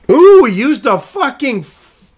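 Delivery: loud, high, drawn-out sarcastic ooh; mock amazement, stretched and punched; mock impressed. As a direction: sneer with exaggerated, slow awe. Mocking the fog machine boast.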